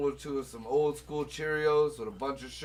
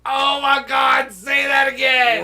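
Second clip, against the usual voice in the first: loud, slow, thrilled outburst; delighted shout; 8 semitones higher, 12 dB louder, slower than usual. Real, not acted. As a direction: shout it out, loving the moment. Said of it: "delighted outburst".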